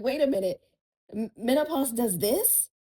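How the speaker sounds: incredulous retold question. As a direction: ask it like the news just hit you. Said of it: "And I'm like".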